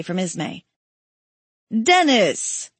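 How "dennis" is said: a glad, lightly raised call of a friend's name; joyful greeting. A narrator's reading. Kotz greeting his friend Parker in the dining room, gladly. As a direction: greet him like a friend back from the dead. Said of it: "Kotz rejoiced".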